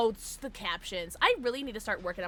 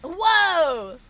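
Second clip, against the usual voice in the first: startled whoa; 9 semitones higher, 15 dB louder than usual. Real, not acted. Startled in game.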